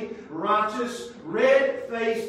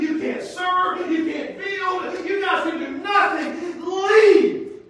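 loud, high, scornful shouting at parents; disciples shooing parents; 9 semitones higher, 5 dB louder than usual. Acted. Disciples shouting at parents to leave, loud and angry.

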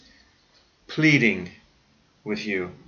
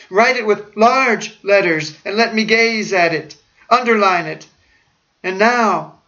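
desperate command; 7 semitones higher, 3 dB louder than usual. Retold or imitated. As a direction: plead with dying urgency. Dying man's demands.

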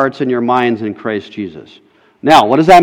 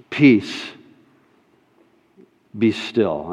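a soft, calm "peace, be still"; soft command; 7 semitones lower, 9 dB quieter, slower than usual. Retold or imitated. Jesus speaking to the storm, soft and calm. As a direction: let the words settle the storm gently.

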